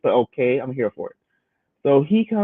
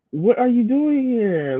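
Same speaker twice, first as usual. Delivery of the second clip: mock surprise; 10 semitones higher, slower than usual. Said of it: "retold confrontation".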